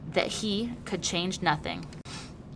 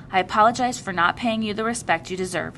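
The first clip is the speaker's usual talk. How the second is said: ordinary delivery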